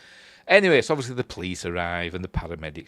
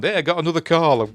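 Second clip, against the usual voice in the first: paramedic calling out; 7 semitones higher, 6 dB louder, faster than usual. Retold or imitated. Paramedic calls out, leaving.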